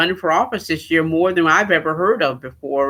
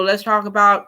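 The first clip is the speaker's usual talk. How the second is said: usual speaking voice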